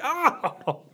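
high laughing yelp; pained yelp. Retold or imitated. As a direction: cry out at the cramp. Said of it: "and I was like".

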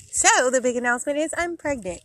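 proud reveal, voice lifting high; real joy spilling into the reveal; excited announcement. Real, not acted. Revealing big pregnancy news.

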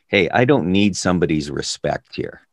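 ordinary delivery

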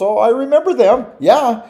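Paul loudly recalling the women who always speak up; exasperated Paul. Retold or imitated. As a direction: play Paul rolling his eyes at the talkers. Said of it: "Paul goes".

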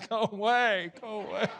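high, mock-frantic plea; comic panicked command; feeble tornado-shooing. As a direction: badly fake bravery, shooing it off in a high voice. Pretends to order a tornado away.

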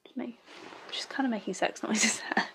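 hushed, grossed-out whisper. Real, not acted. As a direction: whisper it in disgust. Disgusted aside.

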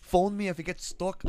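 slow, high-pitched put-on Scottish voice; mock Scottish accent. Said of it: "you have a Scottish accent".

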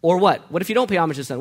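usual speaking voice

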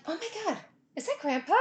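soft, alarmed half-whisper; startled hush. Retold or imitated. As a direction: wake up startled. Waking to screaming.

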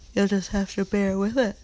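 usual speaking voice

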